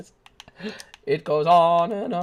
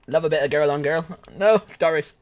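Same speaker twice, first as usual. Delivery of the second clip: usual speaking voice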